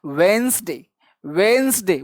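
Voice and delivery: "वेंसडे" in two syllables, wens-day; shortened pronunciation. Shown as a demonstration. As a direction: say "वेंसडे" with no D before the N. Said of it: "कभी कभी इसका sound होता है". ("वेंसडे" दो हिस्सों में, बीच का ड छोड़कर)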